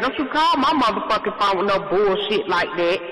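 usual speaking voice

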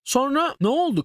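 ordinary delivery

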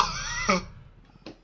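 high hysterical laugh; shrieking laugh. Real, not acted. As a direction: laugh out in relief. Laugh after scare.

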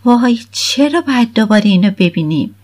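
put-on a sulky teen complaining at the sight of her; mild grumbling girl. A narrator's reading. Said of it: "غرغری کرد".

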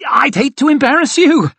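very high, loud squeak; high-pitched, overdone protest. A narrator's reading. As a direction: squeak it out in mock modesty. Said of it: "he squeaked out".

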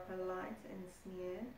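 plain reading voice

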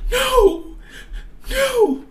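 very high wailing no; high, tearful acted despair; distraught cry. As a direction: cry out in disbelief. Student dreads failing the exam.

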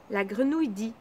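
usual speaking voice

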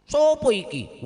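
loud, high Javanese challenge; Javanese accent. Acted. Amr asks in Javanese who stands before him. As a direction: bark it out like a Javanese man. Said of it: "orang Jawa nih".

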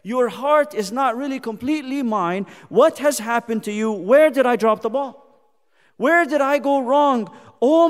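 loud, high, pleading preacher's cry; impassioned lament from the pulpit; preaching at full force. Acted. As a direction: plead with the congregation as God grieving. Pleading sermon climax.

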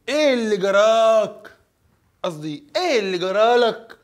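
Egyptian dialect. Shown as a demonstration. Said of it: "وبالمصري بيقولوا".

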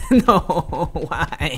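laughing protest. Real, not acted. Enemy chasing, laughing panic.

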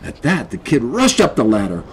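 ordinary delivery

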